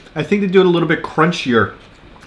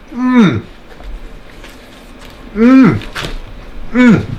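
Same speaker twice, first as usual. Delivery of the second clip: savoring hum; 4 semitones higher, much slower than usual. Real, not acted. Savoring a bite of food.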